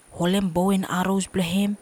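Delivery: ordinary delivery, nothing marked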